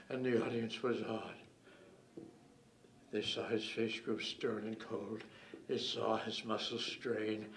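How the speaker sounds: low, hushed mock-epic storytelling; soft, grave verse narration; suspenseful poem recital. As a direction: hold the suspense with long pauses. Recites the poem's suspenseful climax.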